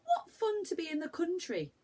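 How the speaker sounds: put-on high, eager child's voice; excited boy. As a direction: bounce it like an excited young boy. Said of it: "said Joe".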